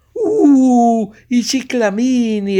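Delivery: esclamazione giuliva, molto alta e forte; joyful exclamation. Acted. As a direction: strillo di gioia alla vista dei fiori. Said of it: "esclamò una signora tutta giuliva". (la signora vede i ciclamini e strilla di gioia)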